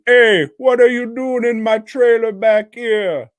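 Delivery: put-on loud, high Jamaican-accent holler; hammed-up Jamaican accent, calling out; mock Jamaican accent. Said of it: "like the fucking guy from Day of the Dead".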